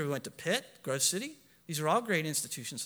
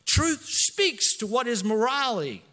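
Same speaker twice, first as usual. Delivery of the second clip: full-force preaching; 5 semitones higher, 5 dB louder than usual. Acted. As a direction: hammer each truth line home. Preaching climax on truth.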